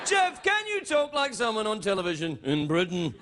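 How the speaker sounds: exaggerated sliding presenter cadence; English TV presenter. Host challenges sidekick to copy him, mock presenter cadence. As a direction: ask it in mock British telly sing-song. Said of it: "talk like an English television presenter".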